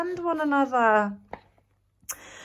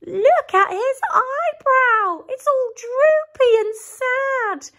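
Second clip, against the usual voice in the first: high, slow, louder bird voice; excited Dot; 12 semitones higher, 4 dB louder, slower than usual. Acted. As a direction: point it out like an eager bird. Dot's excited discovery.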